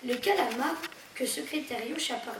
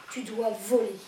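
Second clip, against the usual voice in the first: ordinary delivery, nothing marked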